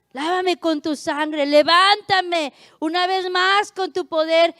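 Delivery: loud, slow cry to God in prayer; impassioned, rising plea from the pulpit; fervent plea. Acted.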